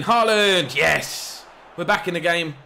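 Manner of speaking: loud, high cry of joy; goal celebration. Real, not acted. Gamer celebrating his striker's goal, loud and high. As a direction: shout it as the ball hits the net.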